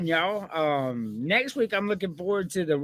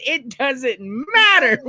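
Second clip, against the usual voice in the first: slow, loud, higher mock catchphrase; The Rock impression; 9 semitones higher, 7 dB louder, slower than usual. Retelling The Rock's promo line, slow loud impression. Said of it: "He said".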